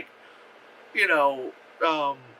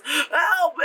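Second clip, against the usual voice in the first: high, drawn-out scream for help; freaking-out mock cry; 11 semitones higher, 4 dB louder than usual. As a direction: imitate a terrified boy crying out. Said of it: "freaking out".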